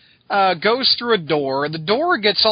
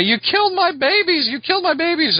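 hammed-up high yell, said twice; mock yell; 9 semitones higher than usual. Scarecrow yelling from the ground, hammed-up high yell. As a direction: wail it again, higher than normal talk. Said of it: "he's on the ground yelling".

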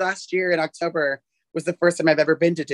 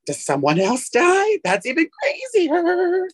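incredulous; 10 semitones higher, slower than usual. Retold or imitated. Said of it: "I'm like".

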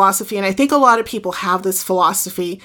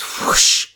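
drawn-out airy whoosh; breathy rush of flame sound; whoosh noise. Retold or imitated. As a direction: imitate a fire suddenly catching. Imitates fire catching.

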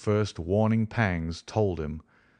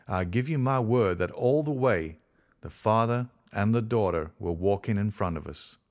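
ordinary delivery

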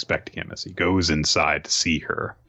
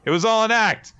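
high, lilting put-on confession; acted, high-pitched playful admission; breezy reveal; 13 semitones higher, 4 dB louder than usual. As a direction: chirp it as a big reveal. Acted cheery confession of prank.

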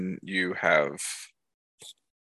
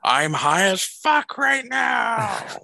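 slow, loud, spaced-out high voice; stoner; 6 dB louder, much slower than usual. Retold or imitated. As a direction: drag it out like you're totally baked. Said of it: "he starts fucking tripping".

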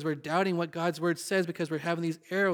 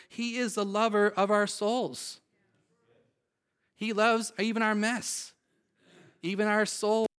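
tender; 4 semitones higher, slower than usual. Real, not acted. Tender words on God's love.

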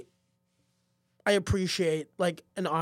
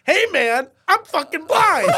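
loud, high-pitched outraged shout; imitated angry audience member shouting; indignant retort; 10 semitones higher, 11 dB louder, slower than usual. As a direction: yell back at the heckler, acted outrage. Blind man retorts angrily to the comic.

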